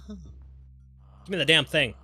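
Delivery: impatient demand. Real, not acted.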